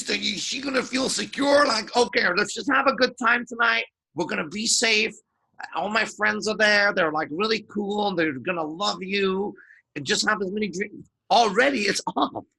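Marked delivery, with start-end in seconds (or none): 2.0-11.1 higher, pushy, eager delivery; insecure high voice. Shown as a demonstration. To a date, the insecure guy's shaky pitch. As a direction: sound nervous and over-eager. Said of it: "if your voice is like this".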